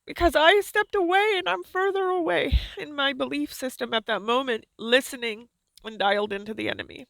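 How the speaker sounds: a divine voice near tears; tearful voice of God. Acted. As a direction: speak as a grieving loving God. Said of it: "He's very emotional".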